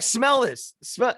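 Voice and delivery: hammed-up insistent dad; dad insisting.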